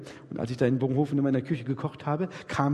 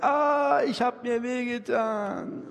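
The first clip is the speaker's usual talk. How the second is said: put-on high wailing child's cry, stretched slow; a little child crying to papa that it got hurt; whining hurt child; 7 semitones higher, slower than usual. Small child comes to papa and wails about the pain, high and slow.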